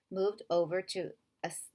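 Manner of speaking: ordinary delivery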